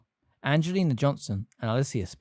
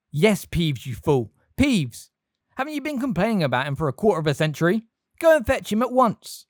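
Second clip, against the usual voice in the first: angry scolding; 7 semitones higher, 5 dB louder than usual. A narrator's reading. McGonagall scolding Filch.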